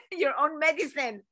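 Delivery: chuckling through the words; amused, laugh breaking in; laughing at herself. Real, not acted. Coach catching her own advice, laughing at herself.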